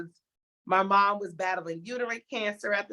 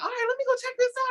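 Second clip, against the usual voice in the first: much higher and fast, a sing-song self-imitation; mock chirpy voice; much faster than usual. Nominee imitating herself going to check, chirpy high voice. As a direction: chirp it up high like a cheerful little voice. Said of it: "And I was like".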